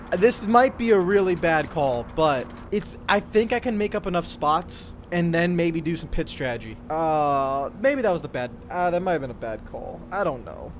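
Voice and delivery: usual speaking voice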